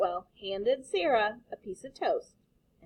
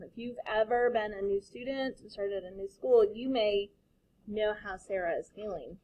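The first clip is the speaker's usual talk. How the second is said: usual speaking voice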